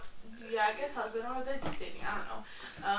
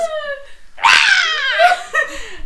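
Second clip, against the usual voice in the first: long, high, loud imitated yell; mock scream; 16 dB louder than usual. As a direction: let out a hammed-up howl that falls away. Acts out his noise after "he goes.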